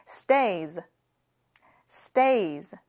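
"Stays" with a clear final s, falling; model pronunciation. Shown as a demonstration. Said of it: "같이 해볼게요". ("Stays" 끝 s 살려 발음)

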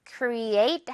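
create slowly, the stress on the second syllable EIGHT; drawn-out model word. Shown as a demonstration. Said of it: "eight 부분에 강세 있습니다".

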